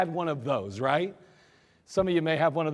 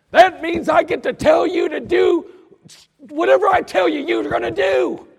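furious shout; 12 semitones higher, 12 dB louder than usual. Retold or imitated. Sergeant yelling that his rank lets him give orders. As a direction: hammer your authority home. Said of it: "he got so mad".

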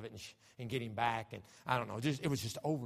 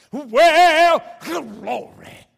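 much louder, high cry of glory; exultant shout; 25 dB louder, much slower than usual. Real, not acted. Preacher rejoicing over a baptized boy, shouting. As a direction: burst out in joy.

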